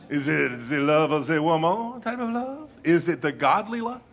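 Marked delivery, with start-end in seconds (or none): 0.7-2.0 put-on amorous French lover accent; playful Frenchman talking romance; mock French accent. Repeats his French lover joke.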